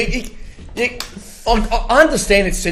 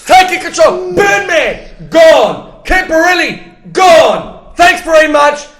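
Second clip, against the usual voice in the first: triumphant shouting; 7 semitones higher, slower than usual. Real, not acted. Fan celebrating new coach, gloating shout. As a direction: crow over the cleared-out players.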